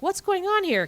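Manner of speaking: high-pitched, startled query, retold; mock alarmed question. Parents coming home to a scene, mock alarm. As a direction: act out baffled parents. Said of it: "they come back and they're like".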